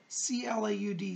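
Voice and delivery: normal talking voice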